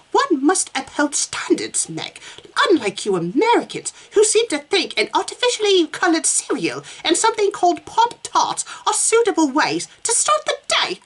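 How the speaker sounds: high, lofty upper-class sniff; pompous English snob voice; snooty toff. Acted. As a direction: look down your nose at the Americans. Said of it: "I'm gonna play myself as a snoot".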